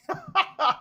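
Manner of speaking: sudden high laugh; burst of laughter. Real, not acted. Host laughing at the busy chat, high burst of laughter.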